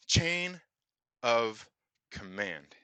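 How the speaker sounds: "Chain", "of", "command" each set apart by a pause; word-by-word emphasis. Acted. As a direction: deliver "Chain of command" as a weighty film quote, one word at a time. Quoting a film line for emphasis.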